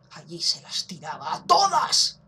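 hushed, slow gossip whisper; sly whisper. Pretend. Susurro pícaro sobre Shadow. (susurro pícaro y lento)

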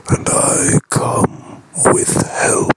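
hushed, whispered moon voice. Acted. The moon speaks to James.

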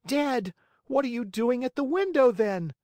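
put-on high, loud, quick boyish disbelief; puzzled boy firing back fast; Pinocchio voice. Pinocchio questions the maiden at the window, high astonished boy.

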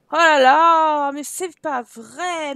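long, drawn-out groan of complaint; slow, wailing frustration; whining exasperation. Real, not acted. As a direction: drag the words out in despair. Game bugs again, complaint.

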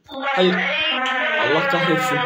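high frustrated cry. Real, not acted. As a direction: curse in high despair. Caught by Baldi. (yüksek perdeden hayal kırıklığı)

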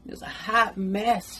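drawn out and emphatic; slow emphatic. Real, not acted. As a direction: stretch each word for effect. Laughing about her messy part.

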